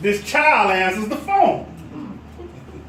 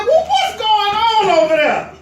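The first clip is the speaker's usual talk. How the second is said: higher, louder alarmed question; alarmed boss; 10 semitones higher, 3 dB louder than usual. Acted. Boss alarmed by helicopter noise, asking high.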